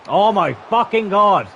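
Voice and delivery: high, loud, drawn-out disbelieving cry; real gamer rage at the screen; outraged outburst. Real, not acted. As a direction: blurt it out at a goal that should not have gone in. Goal conceded during a FIFA stream.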